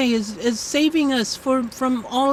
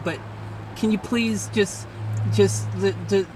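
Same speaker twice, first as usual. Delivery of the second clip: normal talking voice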